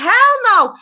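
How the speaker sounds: high, loud mock Hell no; emphatic refusal. Wife refusing the lawyer, high loud scoffing Hell no. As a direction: snap it back like an outraged wife. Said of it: "She's like".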